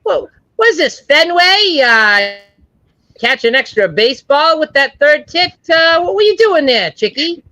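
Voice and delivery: high, hammed-up Boston heckler; mock Boston accent heckling a woman. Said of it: "I might get a little Boston on you".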